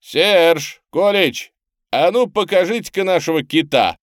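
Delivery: loud, high calls to the crew; shouting. A narrator's reading. Bob calling his shipmates in the boat, shouting. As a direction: holler orders across to the boat. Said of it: "крикнул он в сторону шлюпки".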